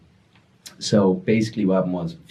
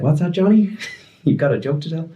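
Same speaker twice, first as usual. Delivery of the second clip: talking to a dog; 9 semitones higher than usual. Retold or imitated. Narrator coaxing mute friend like a dog, mock. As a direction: coo at him like he's Lassie. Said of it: "like Old Yeller or Lassie".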